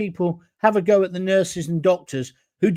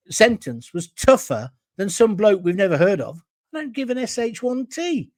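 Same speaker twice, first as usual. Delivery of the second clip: usual speaking voice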